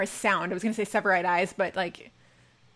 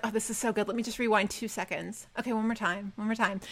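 soft, private indulgent aside; hushed self-talk; 9 dB quieter than usual. Retold or imitated. Fan rewinding a favorite clip, hushed. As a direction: say it softly, as if to yourself.

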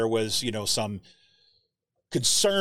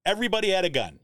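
higher, disbelieving echo; pitched-up mock amazement; incredulous; 7 semitones higher, faster than usual. Real, not acted. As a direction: repeat it like you can't believe it. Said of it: "Can you believe this?".

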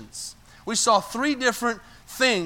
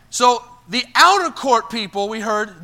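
ordinary talk

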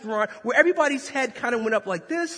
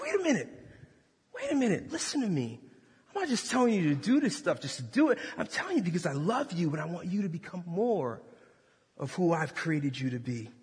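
softer, lower, tender fatherly tone; gentle God voice; 4 semitones lower, 6 dB quieter than usual. Pretend. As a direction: lean in and speak kindly.

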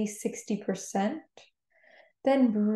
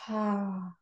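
soft, long exhaled "ah"; demonstrated cleansing exhale, softly released; audible sigh out; 6 dB quieter than usual. Shown as a demonstration. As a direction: let the breath fall out in a gentle sigh. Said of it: "and then let it all out".

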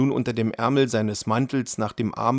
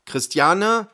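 much higher questioning call of her name; husband calling once more, higher and asking; rising questioning call; 9 semitones higher, 4 dB louder than usual. A narrator's reading. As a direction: call "Christiane?" again, climbing up on the end. Husband calls wife again.

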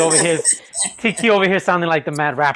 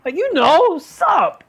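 high-pitched cheeky lawyer voice; mock lawyer; 9 semitones higher than usual. Pretend lawyer asking the judge why, in a high mock voice. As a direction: argue in court like a casual friend. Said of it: "be like".

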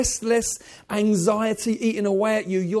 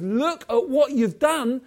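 louder, lifted voice of awestruck thanks; amazed praise; 4 semitones higher, 7 dB louder than usual. Pretend. Believer in eternity praising God, amazed and louder. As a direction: pour out wonder at what he's done. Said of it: "genuinely amazed".

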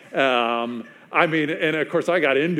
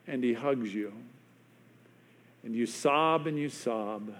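hushed storytelling; 6 dB quieter than usual. Real, not acted. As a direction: let the tender moment land quietly. Tender climax of forgiveness story.

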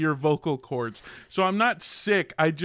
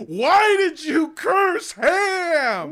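higher, slow mock yell skyward; yelling to the heavens; 13 semitones higher, 4 dB louder, much slower than usual. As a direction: drag it out, yelling at the sky. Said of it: "yelling up at the heavens".

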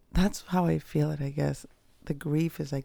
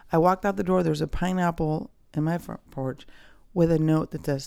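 usual speaking voice

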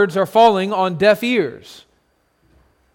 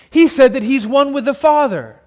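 rising outrage, louder; outraged crowd; 7 semitones higher, 6 dB louder than usual. Acted. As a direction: blurt the accusation, appalled. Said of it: "hiss and whisper violently".

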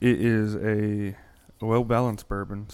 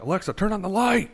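frustrated command; 10 semitones higher, 5 dB louder than usual. Retold or imitated. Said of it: "standing there like".

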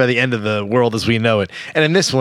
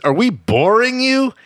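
lightly imitated movie line, higher and slow; Samuel L. Jackson; 9 semitones higher, slower than usual. Co-host quotes the actor's familiar line, lightly imitated indignation. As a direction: stretch it out as a pointed question, lightly acted. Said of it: "tone of voice".

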